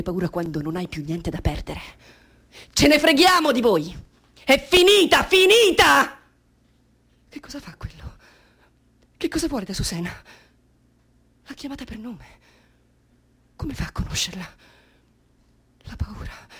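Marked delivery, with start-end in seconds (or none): 2.7-6.2 high, loud, triumphant cry; defiant shout. Acted. 7.3-8.2 tense whisper. Acted. Fearful watching, unvoiced. 13.5-16.5 fearful whisper. Acted.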